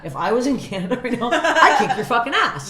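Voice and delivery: loud, high, slowing comic threat; escalated mock threat. Comic topping her threat to a critic, mock fury.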